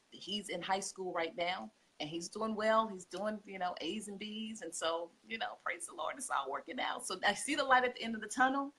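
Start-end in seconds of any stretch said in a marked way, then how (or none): 5.6-6.1 breathy whisper of thanks; whispered praise. Real, not acted. Mom quietly thankful her kids are doing well, whispered. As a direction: whisper it under your breath, relieved. Grateful aside about her children.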